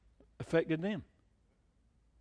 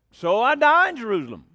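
resolute declaration; 5 semitones higher, 9 dB louder than usual. Retold or imitated. Quoting Paul's resolve.

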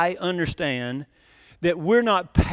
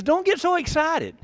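high, lightly voiced quote; quoting scripture; 10 semitones higher than usual. Pastor quoting to his congregation, high pitched. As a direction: lift the verse up high as a gentle rebuke. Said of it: "He says".

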